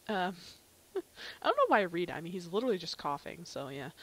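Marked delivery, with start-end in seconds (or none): none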